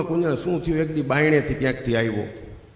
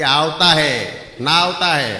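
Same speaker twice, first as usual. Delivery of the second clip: loud, raised mock reply to the visitor; wife's broken Hindi; 4 semitones higher, 5 dB louder, slower than usual. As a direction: call it out firmly in clumsy Gujarati-flavoured Hindi. Wife answers in broken Hindi. (પત્નીની ભાંગીતૂટી હિન્દીમાં મોટેથી જવાબ)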